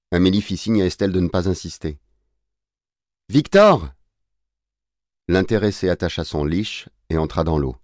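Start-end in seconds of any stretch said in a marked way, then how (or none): 3.3-3.9 high, loud, insistent call. Acted. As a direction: cry the name after someone leaving. Estelle insiste, Victor ne répond pas. (appel aigu, fort et insistant)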